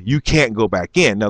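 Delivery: normal talking voice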